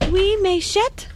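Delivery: schoolgirl French, "Oui, mes chètes" said with care; hesitant French phrase; trying French. Acted. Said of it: "I dare say it's a French mouse".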